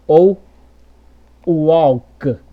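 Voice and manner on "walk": walk with a U glide before K; wrong U-like L. Shown as a demonstration. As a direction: turn the L of walk into U. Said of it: "Você não vai falar assim".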